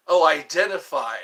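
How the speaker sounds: sudden loud oh; surprised correction. Real, not acted. Catching his own misreading of the chat. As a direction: blurt out the correction.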